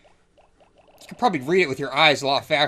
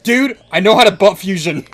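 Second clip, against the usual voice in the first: excited; 6 semitones higher, 12 dB louder than usual. Retold or imitated. Excited quote of his younger self.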